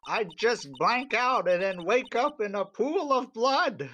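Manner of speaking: character voice. Acted. Character voice in game.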